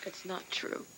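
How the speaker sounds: whispered denial. Acted. Wife answering her husband's accusation, whispered hurt denial. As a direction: breathe the words out, hurt. Tina denying, devastated.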